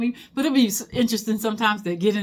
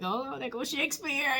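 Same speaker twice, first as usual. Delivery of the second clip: hushed gossip; 5 semitones higher, 10 dB quieter than usual. Retold or imitated. Friends spotting Shakespeare in undergrad.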